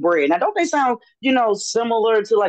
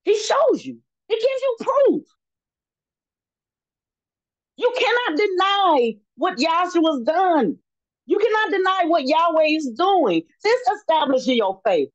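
higher-pitched, emphatic sermon delivery; fervent preaching; 7 semitones higher than usual. Real, not acted. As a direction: preach it with drawn-out key words. Preaches at full force.

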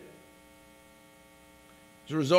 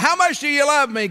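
higher, louder call into the jungle; yell; 9 semitones higher, 5 dB louder than usual. Retold or imitated. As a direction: shout the question out into the darkness. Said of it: "and yell out".

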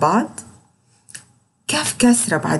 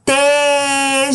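very slow تاج, higher, aa held; long drawn vowel; 5 semitones higher, much slower than usual. Shown as a demonstration. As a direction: stretch the aa in تاج. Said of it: "شايفين بقي هيحصل له ايه".

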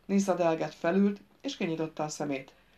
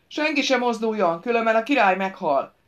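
loud, raised threat over the crowd; shouted command; 4 semitones higher, 8 dB louder than usual. A narrator's reading. Royce warns the whole courtyard not to move, shouted command. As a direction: bellow the order so everyone hears it. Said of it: "belekiáltotta parancsát a némaságba".

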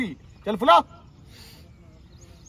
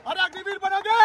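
shouted question; 9 semitones higher than usual. Real, not acted. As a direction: call him out sharply. Recruiter barking at a recruit.

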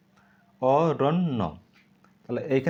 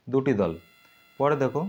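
ordinary talk

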